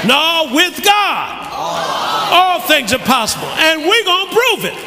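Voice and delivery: shouted, high preaching with a laugh; full-force preacher call. Acted. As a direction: fire up the congregation.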